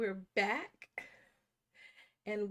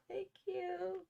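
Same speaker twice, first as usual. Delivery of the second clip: high, soft, bashful thank-you; 10 semitones higher, 5 dB quieter than usual. Real, not acted. Host thanks viewers for congratulations on her milestone, bashful. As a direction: thank them softly up high, as if blushing. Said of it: "I'm blushing".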